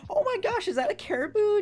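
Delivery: very high excited squeal; squeaky girly voice. Retold or imitated. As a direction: squeal like a dazzled girl. Girls imitated seeing mirage.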